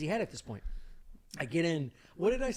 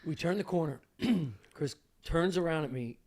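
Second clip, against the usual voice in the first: normal talking voice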